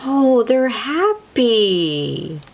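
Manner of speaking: usual speaking voice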